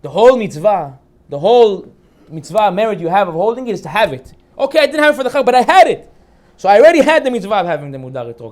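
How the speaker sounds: fervent. Real, not acted. Preaching the lesson loudly.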